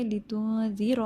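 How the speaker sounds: slow "لتنذر" with the n held as nasal hum; hidden noon. Shown as a demonstration. Teacher modelling "لتنذر", slow with noon hidden. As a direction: hide and stretch the n in "لتنذر". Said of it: "یہاں نون کی آواز چھپا لیں".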